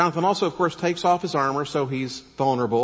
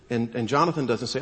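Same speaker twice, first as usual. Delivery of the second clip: usual speaking voice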